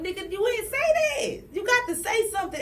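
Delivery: high-pitched scolding retort. Retold or imitated. Retold scolding of boyfriend, high-pitched disbelief. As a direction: snap back high and sharp.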